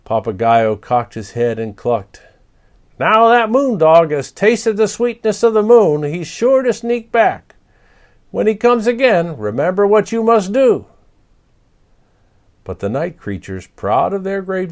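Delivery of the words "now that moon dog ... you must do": high, loud parrot warning. Acted. Papagayo warning the creatures, high loud parrot voice. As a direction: wise bird cautioning his friends.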